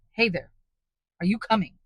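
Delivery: loud, rough, scornful hail; contemptuous call. A narrator's reading. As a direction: bark at him with contempt.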